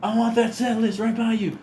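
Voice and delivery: high excited fan voice; eager fan voice. Retold or imitated. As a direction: pitch it way up, eager. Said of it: "It's like".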